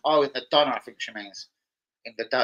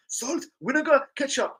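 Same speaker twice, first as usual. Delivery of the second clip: high, drawn-out Turkish kebab man; mock Turkish accent; 11 semitones higher than usual. Friend watches kebab man list sauces, slow high Turkish accent. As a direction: stretch each item in a Turkish accent. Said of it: "Watch how he says vinegar".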